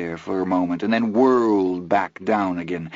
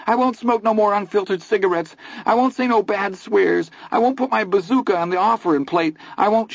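much higher, fast panicky pleading; frantic promises; 12 semitones higher than usual. A narrator's reading. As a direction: bargain with God in terror. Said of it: "in the extremity of his fear".

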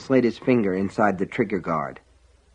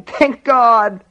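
high, loud, tearful relief; distraught, high-pitched cry; 12 semitones higher, 5 dB louder than usual. A narrator's reading. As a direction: wail it out in desperate relief. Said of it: "Sheila was crying".

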